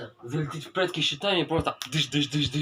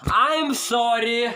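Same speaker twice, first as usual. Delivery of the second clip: high, slow, loud I'm sorry; loud drawn-out apology; 11 semitones higher, 7 dB louder, much slower than usual. Shown as a demonstration. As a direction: belt out a long, stretched apology. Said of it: "вот так сделаю".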